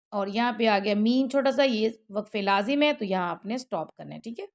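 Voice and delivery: ordinary delivery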